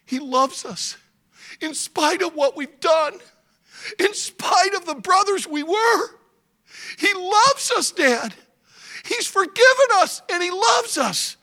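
building from plain to high and loud; rising exclamation. Acted. Son Judah proclaiming to his father, building loud. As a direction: swell it to a joyful climax. Son's climactic declaration.

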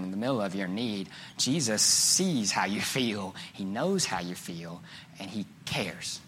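low, soft and slowed reassurance; hushed, heartfelt pastoral comfort; tender consolation. Real, not acted. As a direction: comfort them softly, letting key words stretch. Preacher consoling the congregation.

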